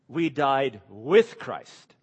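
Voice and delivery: slow, leaning hard on "with"; stress on WITH. Shown as a demonstration. Preacher correcting the flock, stressing "with" slowly. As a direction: land "with" heavily to set it against "like". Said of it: "Paul doesn't say we simply died like Christ".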